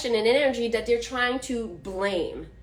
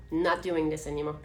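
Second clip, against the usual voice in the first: laughing repeat; 7 semitones lower, 4 dB quieter, faster than usual. Real, not acted. Repeats vow, breaking into laugh.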